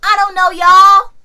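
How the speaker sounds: I don't know y'all slow and loud, rising on "y'all"; emphatic. Real, not acted. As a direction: stretch "y'all" out loud and high. Repeated line with shift.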